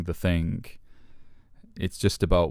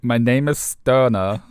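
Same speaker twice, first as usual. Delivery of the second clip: a higher, louder, slow self-introduction as Sterner; mock grand intro; 6 semitones higher, 6 dB louder, slower than usual. To a friend, playing the character Sterner, hammed-up. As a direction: announce yourself grandly, hamming it up. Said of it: "I should do it in a German accent".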